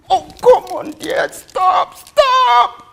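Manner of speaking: high, very loud, desperate cry; anguished shout. Acted. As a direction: cry out to make the child stop. Mother begs child to stop.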